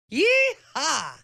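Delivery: high whooping cheer. Acted. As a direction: let out a gleeful yee-haw. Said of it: "cheered Marvin".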